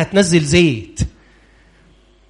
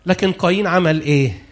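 normal talking voice

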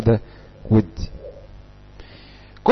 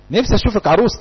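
higher-pitched imitation of a wishful parent; nagging parent; 7 semitones higher than usual. Parent wishing to see the child married, mildly nagging. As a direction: lightly voice a parent's pet refrain. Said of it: "طيب بابا وماما بقى".

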